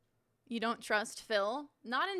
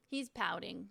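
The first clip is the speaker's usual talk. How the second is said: ordinary delivery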